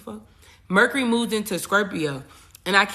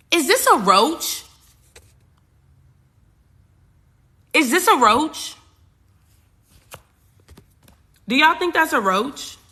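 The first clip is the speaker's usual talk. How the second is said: much higher, louder alarmed question; 9 semitones higher, 6 dB louder than usual. Real, not acted. Spotting a bug on camera, alarmed. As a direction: squeal at the bug. Spots a bug mid-video.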